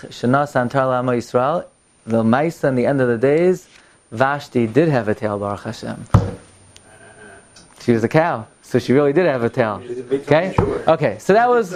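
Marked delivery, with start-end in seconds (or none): none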